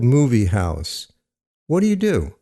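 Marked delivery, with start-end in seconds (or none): none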